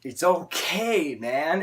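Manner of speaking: slow earnest mock reassurance; reassuring quote. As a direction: soothe a panicking friend. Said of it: "Justin's like".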